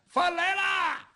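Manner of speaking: high, slow drawn-out call. Acted. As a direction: sing out that the food has arrived. 外边有人喊饭来了. (拖长声吆喝饭来啦)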